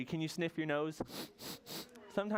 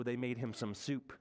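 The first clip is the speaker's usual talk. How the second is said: ordinary delivery, nothing marked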